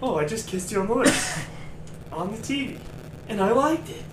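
high, giddy quote; breathless and thrilled; giddy actress. Retold or imitated. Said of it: "she's like".

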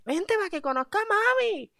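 put-on high, coaxing girlfriend voice; girlfriend's voice. Girlfriend urging boyfriend to meet her mom, high coaxing. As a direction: coax him over sweetly and high. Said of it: "Y ella me dice".

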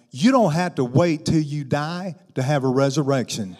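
loud, driving sermon delivery; full-force preaching. Acted. Preacher tells the congregation resurrection is now, loud preaching. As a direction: preach it out to the whole room.

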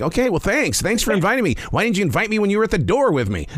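mild mock-hurt Jesus asking why he wasn't invited; voicing Jesus.

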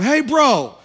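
put-on loud, high, half-drunk insistence; loud slurred encouragement from a drunk guy; loud drunk preaching. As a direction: shout it like a tipsy stranger who means it. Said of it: "he starts talking real loud".

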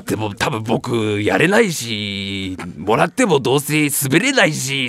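put-on low, slow sulking kid; sulky child. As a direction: mope like a kid. (すねた子供の声)

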